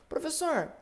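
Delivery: high-pitched pupil hailing the teacher; student calling out. Acted. Student interrupting the professor with a question, high-pitched call. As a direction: lift the voice high to get the teacher's attention.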